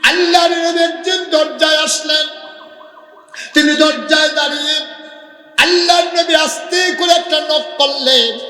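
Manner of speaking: high, loud melodic sermon cry; impassioned storytelling sermon, pitched high; full-force preaching. A narrator's reading. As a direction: preach it out at the top of your range.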